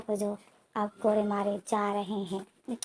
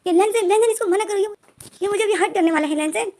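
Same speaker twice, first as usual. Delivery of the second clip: retold scream, much higher and louder; screaming for help; 8 semitones higher, 6 dB louder than usual. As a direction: yell desperately for Lenjen to stop him. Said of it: "wing जो चीख कर बोलता है". (Wing लेनजेन से मदद के लिए चीखता है)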